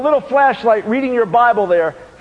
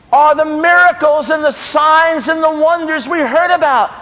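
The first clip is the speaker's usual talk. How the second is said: high, raised, drawn-out preaching cry; impassioned challenge; 8 semitones higher, 4 dB louder, slower than usual. Retold or imitated. Gideon asking where the miracles are, high impassioned challenge. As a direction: challenge God, stretching the key words. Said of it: "And he says".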